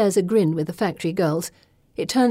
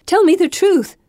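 much higher, loud cry; desperate plea; 12 semitones higher, 8 dB louder than usual. A narrator's reading. Gemma pleads with Pippa, crying out. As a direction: beg for the truth at full voice.

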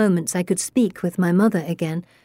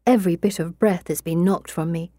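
plain reading voice

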